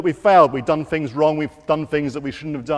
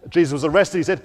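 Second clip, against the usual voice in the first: usual speaking voice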